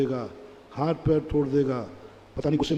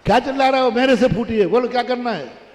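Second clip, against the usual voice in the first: raised, louder challenge to son; mother's raised, louder defiance; 5 semitones higher, 5 dB louder, faster than usual. Acted. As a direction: confront him head on. Mother confronts son loudly.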